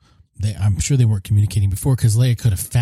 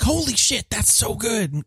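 pitched way up in delighted awe; amazed; 11 semitones higher, 5 dB quieter than usual. Retold or imitated. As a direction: blurt it out like you just got it. Amazed reaction on second viewing.

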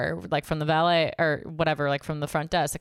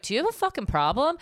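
raised-pitch indignant challenge; retold confrontation; 8 semitones higher, faster than usual. Customer confronting waiter over the check, indignant.

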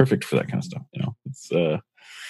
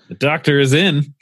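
booming, higher announcer voice; announcer line; 9 semitones higher than usual. Pretend. Quoting the radio doctor's slogan as a booming announcer. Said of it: "joking about Dr. Dean Adele".

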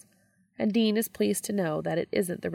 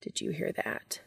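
whisper; 6 dB quieter than usual. A narrator's reading. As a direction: breathe it into his ear. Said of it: "whispers into his ear".

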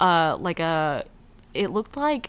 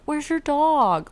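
high, slow drawn-out question; imitating the neighbor; 8 semitones higher, much slower than usual. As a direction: stretch the question out. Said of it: "he'd be like".

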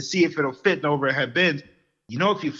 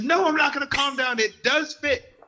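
much higher, arguing back hot; heated refusal; 11 semitones higher than usual. Retold or imitated. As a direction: refuse to calm down, pitched way up. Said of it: "I was getting heated".

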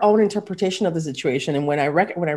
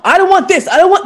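higher and louder, blurting what they don't want; mimicking a client; 10 semitones higher, 7 dB louder than usual. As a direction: answer fast and sure of what you don't want.

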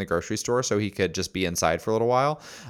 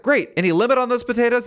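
much higher, calling out across the store; eager shopper; 6 dB louder than usual. Retold or imitated. Mom with a stroller hollering to the produce guy, higher. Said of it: "she's like".

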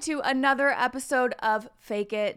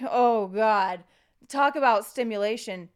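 loud, cracking voice; 7 dB louder than usual. Real, not acted. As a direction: voice breaks as it gets louder. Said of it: "my voice cracked".

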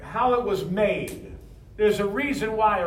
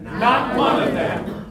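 emphatic proclaiming; 7 dB louder, slower than usual. Shown as a demonstration. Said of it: "everybody say".

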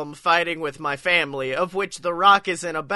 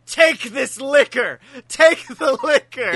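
urgent shout; 7 semitones higher, 5 dB louder than usual. Retold or imitated. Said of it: "was like".

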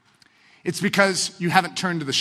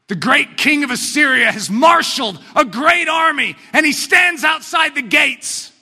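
louder, higher, slowing proclamation; dramatic preaching; 6 semitones higher, 5 dB louder than usual. Acted. Preacher setting the siege scene, booming. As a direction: announce the siege like a herald.

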